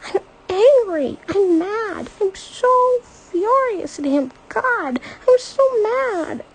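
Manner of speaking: high, loud furious crying shout; raging through tears at grandpa; screaming in anger. A narrator's reading. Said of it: "She's screaming".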